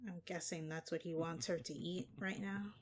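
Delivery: ordinary delivery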